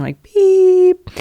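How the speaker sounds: a sudden high, loud beep sound; imitated beep. Telling the podcast host about the ringing she heard, high loud beep. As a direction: mimic the ringing tone clogging her ears. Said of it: "I start hearing".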